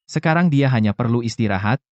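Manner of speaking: ordinary talk, nothing marked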